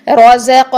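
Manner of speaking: رزق with a clear voiced z; buzzing za. Shown as a demonstration. Teacher showing za again, رزق with ringing z. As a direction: let the whistle and voice run in رزق. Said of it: "زا میں سیٹی بھی ہونی چاہیے".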